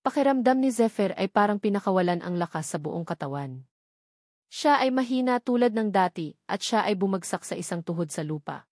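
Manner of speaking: ordinary delivery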